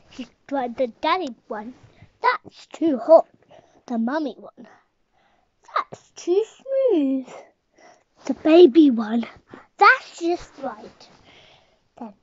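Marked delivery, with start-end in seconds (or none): 2.2-3.3 slow, loud big bear; daddy bear voice. Acted. Daddy bear tasting porridge. 5.7-7.3 slow, high mummy bear. Acted. Mummy bear tasting porridge. 9.8-11.1 slow, high and loud; pleased little baby bear. Acted. Baby bear tasting porridge.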